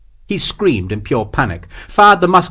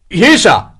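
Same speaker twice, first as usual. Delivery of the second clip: high, loud call back; shouted answer; 7 semitones higher, 7 dB louder than usual. A narrator's reading. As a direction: yell your position to the captain. Answering officer's shout in battle.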